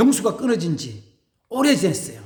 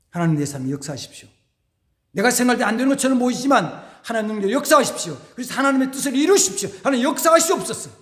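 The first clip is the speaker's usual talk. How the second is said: fervent prayer; 3 semitones higher, faster than usual. Acted. Voiced prayer, rising fervour.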